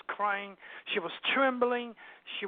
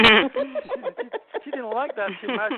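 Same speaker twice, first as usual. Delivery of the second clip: high, loud laughter through the words; laughing; 5 semitones higher, 9 dB louder, slower than usual. Real, not acted. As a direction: laugh while telling it, voice jumping up. Laughing after teasing remark to wife.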